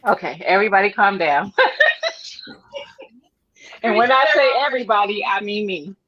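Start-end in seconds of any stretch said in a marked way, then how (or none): 1.6-2.5 high laugh. Real, not acted. Laughs at her own fear.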